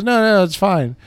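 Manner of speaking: high, loud imitation of mom saying it's fine; mock-cheerful mom voice; mom brushing it off. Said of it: "She goes".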